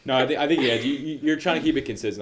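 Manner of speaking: talking through laughter; amused laughing reassurance. Real, not acted. Laughs after forgotten question.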